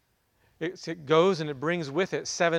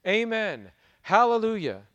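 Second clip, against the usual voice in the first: exclaimed praise; 6 semitones higher, 5 dB louder than usual. A narrator's reading.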